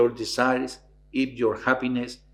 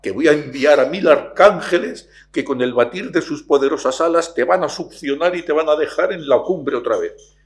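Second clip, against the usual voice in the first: louder, solemn heavenly voice; voice of God; 4 semitones higher, 7 dB louder than usual. Retold or imitated. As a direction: boom down like God from the clouds. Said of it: "se oye una voz celestial". (voz celestial y solemne)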